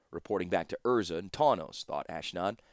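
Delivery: usual speaking voice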